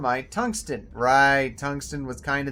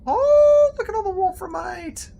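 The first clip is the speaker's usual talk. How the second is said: much higher and louder, amazed; delighted squeal; 9 dB louder than usual. Real, not acted. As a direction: gasp with glee at the find. Sudden delight at ore.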